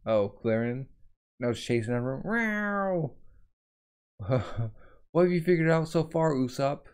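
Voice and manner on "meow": high mock meow; cat meow. Imitating monster cat.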